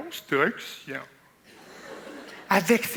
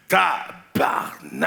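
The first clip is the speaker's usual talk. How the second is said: slow, low groaned tabarnak; drawn-out curse; 12 semitones lower, much slower than usual. Retold or imitated. Coworker on the roof, slow low curse.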